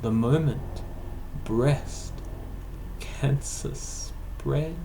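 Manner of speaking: slow, lilting recital with long-held, chant-like vowels; poem recital. Acted. Poet sharing a villanelle about music therapy, slow singsong recital. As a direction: stretch key words out on sustained notes, pausing between phrases.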